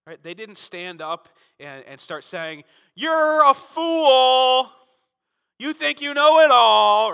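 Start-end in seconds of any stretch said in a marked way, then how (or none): 3.0-7.1 loud, high, slow scornful yell; a hammed-up angry shout at Apollos; mock harsh rebuke. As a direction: blast him like a furious critic.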